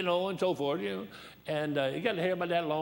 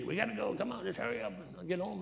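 higher-pitched impatient urging; mock impatience, pitched up; fidgety wife; 6 semitones higher, 3 dB quieter than usual. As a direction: imitate a fidgety wife hurrying everyone. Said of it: "she's a little, you know, fidgety".

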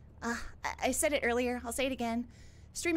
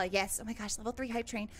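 normal talking voice

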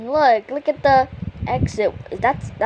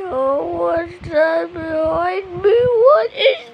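mock scared; 6 semitones higher, slower than usual. Spooked by something.

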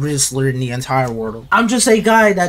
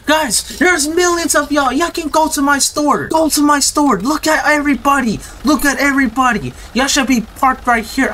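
1 calling out to crowd; 8 semitones higher than usual. Real, not acted. Streamer calling the crowd in, high and eager. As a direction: shout to the customers outside to come in.

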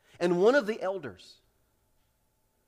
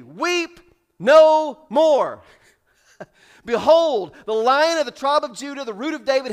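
loud proclamation, no more weeping; proclaiming; 6 semitones higher, 10 dB louder than usual. Acted. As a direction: announce it boldly like an elder. Elder's comforting proclamation voiced.

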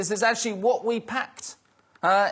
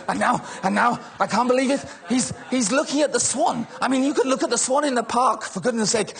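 ordinary delivery

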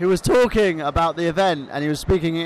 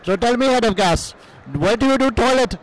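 put-on Iranian cousin accent; 2 semitones higher than usual. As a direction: play the foreign cousin with a thick accent. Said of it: "[name]'s Iranian cousin".

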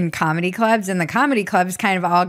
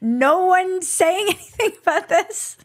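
laughing; 10 semitones higher than usual. Retold or imitated. Quoting own disbelief, laughing.

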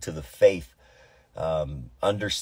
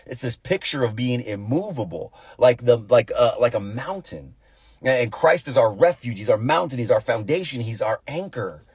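higher, louder pulpit preaching; full-force preaching; 5 semitones higher, 3 dB louder than usual. Acted. Pastor urging believers to stand firm, fervent preaching. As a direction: preach it like a rising altar call.

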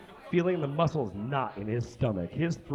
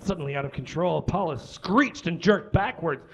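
usual speaking voice

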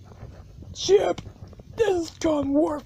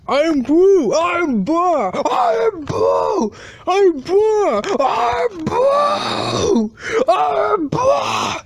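climbing into a high shout; escalating exasperation; 6 semitones higher, 4 dB louder, slower than usual. Acted. Teacher and learner arguing over pronunciation, exasperated rising shouts. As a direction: alternate the two takes, ever higher and louder.

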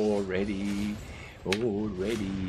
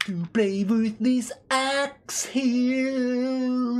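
much higher, slow, stretched-out silly voice; stretched cartoon voice; 6 dB louder, much slower than usual. Gamer showing off his axe in a silly stretched voice. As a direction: drag it out like a goofy cartoon character.

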